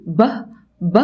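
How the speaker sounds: ب released with air stored behind the lips; b sound. Shown as a demonstration. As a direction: burst ب from closed lips. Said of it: "بخزن كل الهواء ورا شفايفي".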